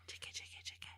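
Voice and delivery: hushed whisper. Pretend. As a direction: breathe it, barely voiced. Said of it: "Did you whisper to him?".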